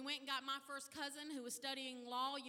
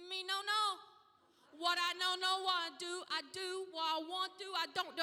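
put-on higher little boy voice, tangled words; child's voice; 5 semitones higher, 6 dB louder than usual. Little son answering his yelling mother, higher childish voice. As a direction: play the small boy fumbling his excuse. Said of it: "And he said".

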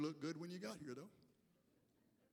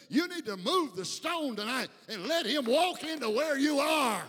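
rising, louder preacher build; full-force preaching; 7 semitones higher, 11 dB louder than usual. Acted. Pastor urging the church, building preacher cry. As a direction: climb it up like a revival sermon.

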